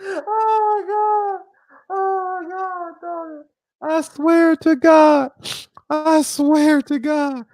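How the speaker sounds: high, very slow laughing wail; helpless laughter. Real, not acted. Streamer overcome by own pie story, high laughing wail. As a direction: drag it out high, crying with laughter.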